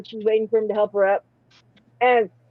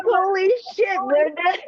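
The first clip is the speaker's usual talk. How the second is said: shocked; 9 semitones higher, slower than usual. Retold or imitated. Shocked at Brenda's remark.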